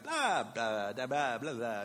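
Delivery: lightly imitated baby babble; playful mock baby talk; babbling baby. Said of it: "he's sitting up in his crib, and he's like".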